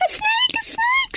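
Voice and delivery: very high panicked shriek; terrified scream. Acted. Nafuna seeing the snake around her sister, shrieking. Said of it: "screamed Nafuna".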